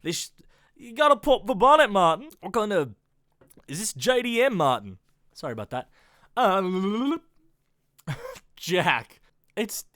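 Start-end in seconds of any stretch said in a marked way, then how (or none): 0.0-5.0 put-on louder, playful Australian accent; mock Australian car-show banter; imitated Australian YouTubers. Fan imitates car hosts calling Martin, playful Australian accent. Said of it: "really into Mighty Car Mods on YouTube".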